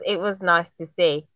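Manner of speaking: ordinary talk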